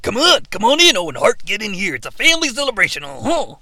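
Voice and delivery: swooping pitch, quick wrestler impersonation; put-on voice with big pitch swoops; Macho Man. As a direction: imitate a wrestler, swinging up and down. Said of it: "Macho Man holds open the ropes".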